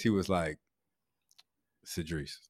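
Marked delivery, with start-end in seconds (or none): 1.9-2.4 very low, hushed name-drop; quiet, deep interruption; imitating a therapist. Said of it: "J T did like this".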